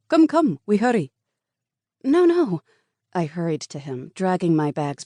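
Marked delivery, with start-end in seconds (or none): 0.1-1.1 high, loud hurried urging; urgent cab driver. A narrator's reading. Cab driver rushing the hurt woman inside. 2.0-2.6 high, loud quick protest; frantic refusal. A narrator's reading. Woman refusing the hospital to the driver.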